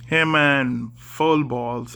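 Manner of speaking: usual speaking voice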